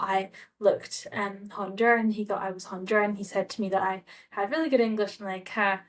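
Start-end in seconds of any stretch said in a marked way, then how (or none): none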